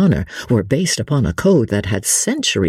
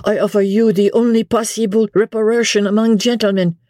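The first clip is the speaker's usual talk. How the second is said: put-on high, earnest French-accented explanation; broken English, French accent; 10 semitones higher than usual. A narrator's reading. Vicomte offers reparation among gentlemen, earnest French accent. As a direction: insist as a young Frenchman struggling with English. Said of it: "in still more broken English".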